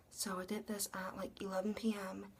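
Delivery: quick secretive whisper. Real, not acted. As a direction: whisper like not waking mom. Mom was asleep at the time.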